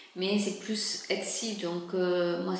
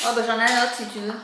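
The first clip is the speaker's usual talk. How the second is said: louder on the phone; 2 semitones higher, 9 dB louder, much faster than usual. Real, not acted. Speaking on the phone, raised voice.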